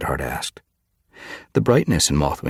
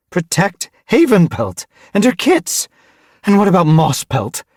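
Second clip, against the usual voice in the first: loud, high, indignant questions; bristling; 9 dB louder than usual. A narrator's reading. Mothwing retorts that kits need protecting, bristling. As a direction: throw the questions back angrily. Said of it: "Mothwing bristled".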